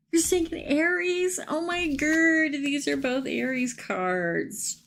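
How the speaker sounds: high, astonished gasp of disbelief; oh my god, she called it; pitched right up in amazed delight, slowing down. Real, not acted. A viewer guesses the sign and the cards match.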